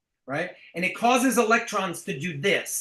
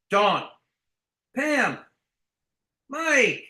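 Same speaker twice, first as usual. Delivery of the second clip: emphatic; 5 semitones higher, 3 dB louder, much slower than usual. Shown as a demonstration. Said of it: "you gotta be emphatic".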